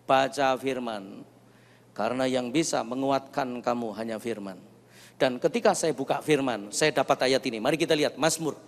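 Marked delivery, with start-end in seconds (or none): 0.0-4.6 low, measured, quiet instruction; retold divine word, hushed and deep; voice of God. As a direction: speak as God, low and unhurried. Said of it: "Tuhan bicara begini".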